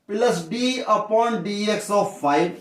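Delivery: spelled out very slowly for notes; teacher dictating at writing speed; slow dictation. Shown as a demonstration. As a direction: say it slow enough to write down.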